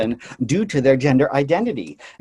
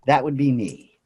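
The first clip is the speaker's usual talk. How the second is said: normal talking voice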